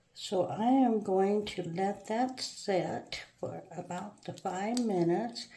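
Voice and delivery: usual speaking voice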